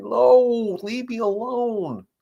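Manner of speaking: high, pleading dog voice, slow on alone; voicing the dog. Trainer voicing a scared dog begging people to back off, high and pleading. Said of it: "the dog is sitting there saying".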